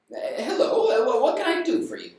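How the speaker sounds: high, quick fluttery housewife voice; a hint of a nervous old lady at the door; old wife surprised by a caller; flustered old wife.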